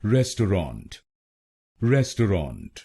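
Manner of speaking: Restaurant with the weight on res; restaurant said RES-tau-rant; stress on res. Shown as a demonstration. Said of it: "RES पर जोर डालना है".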